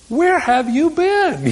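high, slow disbelief; incredulous Jesus. Retold or imitated. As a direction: ask it as if they missed everything. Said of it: "And yet Jesus says".

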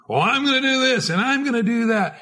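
hammed-up boast about big plans; boastful brag. Preacher mimics boastful Christians, mock bragging. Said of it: "Christians here that are boastful".

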